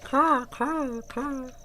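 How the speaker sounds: very high squawking crow voice, drawn-out meh; silly crow. Pretend. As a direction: play a dopey crow claiming its country. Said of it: "I'm a crow".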